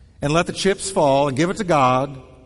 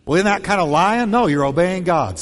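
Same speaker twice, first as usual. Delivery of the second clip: normal talking voice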